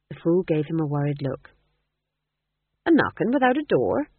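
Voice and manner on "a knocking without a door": high, loud, wary question; suspicious. A narrator's reading. Fool suspicious of the porter's riddle, high and wary. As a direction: ask it like there's a trick hidden in it. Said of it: "he said suspiciously".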